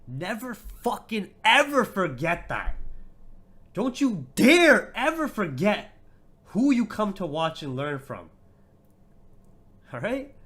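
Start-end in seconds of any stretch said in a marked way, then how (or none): 0.1-2.8 higher, louder, slow and punched; loud slow warning. Real, not acted. Streamer warns chatter, loud, slow and menacing. 3.7-8.3 much higher and louder, dragged out; furious slow shout. Real, not acted. Streamer shouting down a chatter, slow and furious.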